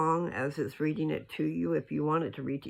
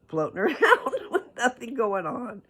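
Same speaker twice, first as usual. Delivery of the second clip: laughing through it; 9 semitones higher, 6 dB louder than usual. Real, not acted. Laughing at bad guys floating.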